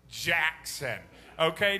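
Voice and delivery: ordinary talk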